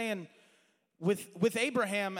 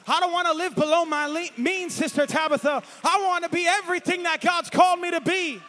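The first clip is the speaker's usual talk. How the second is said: higher and louder, shouted pulpit climax; fiery shouted preaching; full-force preaching; 7 semitones higher, 8 dB louder than usual. Acted. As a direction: drive it home to the church.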